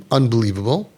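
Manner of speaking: ordinary talk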